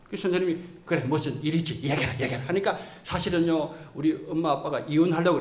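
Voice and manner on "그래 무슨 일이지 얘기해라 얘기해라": low, soft, reassuring; teacher softly calming a crying student; gentle comforting. Retold or imitated. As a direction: coax the boy gently to talk.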